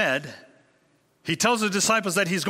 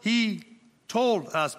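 Eve; 7 semitones higher, slower than usual. Retold or imitated. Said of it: "And Eve said".

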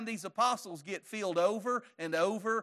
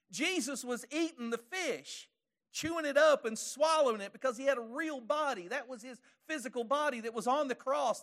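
preaching at full force; 7 semitones higher, 4 dB louder than usual. Acted.

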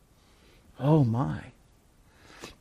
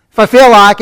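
a hint of an offhand shrug, louder; mock careless healer; 5 semitones higher, 8 dB louder than usual. Mocking a grudging healer to the congregation, offhand. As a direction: toss it off like a careless doctor. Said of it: "He doesn't say".

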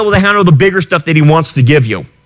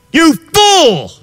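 angry rebuke; 10 semitones higher than usual. Acted. Preacher rebukes the complacent.

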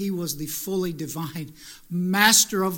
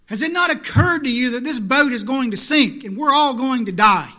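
loud, high, panicked plea; panicked cry; 3 semitones higher, 8 dB louder than usual. Acted. Disciples shouting at Jesus in the storm, panicked. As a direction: cry out as if the boat is sinking.

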